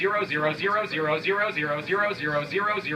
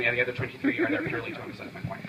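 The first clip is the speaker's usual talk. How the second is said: usual speaking voice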